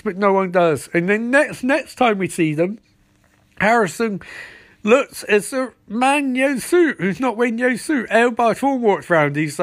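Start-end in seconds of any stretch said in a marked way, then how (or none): none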